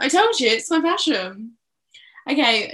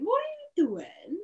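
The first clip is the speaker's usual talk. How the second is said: put-on high Welsh-accented question; a hint of Welsh mum, pitched up; Gwen impression; 10 semitones higher, 4 dB quieter than usual. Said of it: "It's Gwen".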